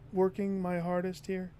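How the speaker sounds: ordinary talk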